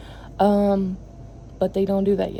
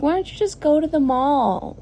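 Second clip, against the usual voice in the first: higher, lightly mocked naive question; mocking others; 7 semitones higher than usual. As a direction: parrot their easy advice. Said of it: "they're like".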